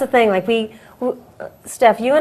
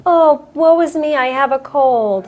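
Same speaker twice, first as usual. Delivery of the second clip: mock self-pity; 5 semitones higher than usual. Mocking people who moan about a mere cold, self-pitying. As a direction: put on a woeful, complaining voice.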